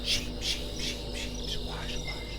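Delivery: whispered, voiceless monster chant; eerie whispered creature in the woods; creature call. Acted. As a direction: hiss it softly like something lurking.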